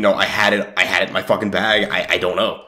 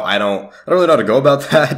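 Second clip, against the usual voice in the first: ordinary talk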